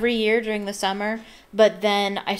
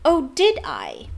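slow, higher indignant challenge; dangerous; 4 semitones higher, 3 dB louder, slower than usual. A narrator's reading. Girl challenges the boy who doubts her, dangerously. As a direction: draw it out, daring him. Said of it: "her eyes flashed dangerously".

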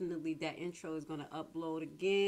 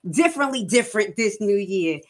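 hyped show intro; 16 dB louder than usual. Acted. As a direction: announce the show like a big entrance. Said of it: "Y'all like the intro?".

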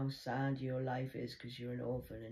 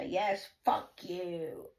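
daughter's slow curse; 6 semitones higher, 4 dB louder, much slower than usual. Retold or imitated.

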